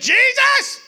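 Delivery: very high, loud wailing shout of his name; Mary's anguished cry. Acted. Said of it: "and said".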